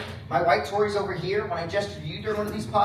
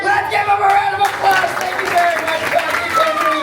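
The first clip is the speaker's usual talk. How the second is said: high, loud hype over applause; real emcee shout, pitch way up; rousing shout; 14 semitones higher, 10 dB louder than usual. Real, not acted. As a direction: whip the crowd into applause. Hyping applause over a cheering room.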